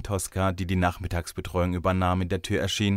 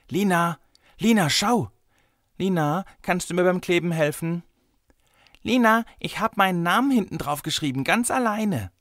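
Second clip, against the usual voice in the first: put-on high, eager child calling for attention; excited children; 10 semitones higher, 4 dB louder than usual. A narrator's reading. Kindergarten children all vie for their teacher, excited and high-pitched. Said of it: "dass sie alle so aufgeregt waren".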